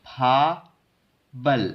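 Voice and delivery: फाबल very slowly, syllable by syllable; drawn-out फा, then बल with a b; linked reading. Shown as a demonstration. Teacher demonstrates how to read it, slow linked फाबल. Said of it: "इसको जो पढ़ेंगे".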